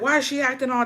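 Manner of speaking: high-pitched, indignant question; heated retold complaint. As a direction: imitate a worked-up dad demanding answers. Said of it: "He was heated too".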